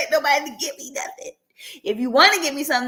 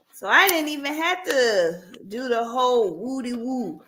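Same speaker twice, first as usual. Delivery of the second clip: ordinary talk, nothing marked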